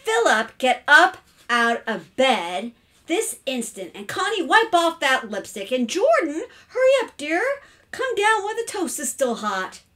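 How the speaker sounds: high, loud summons. A narrator's reading. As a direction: call out firmly to the household. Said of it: "Missus Carmody said louder".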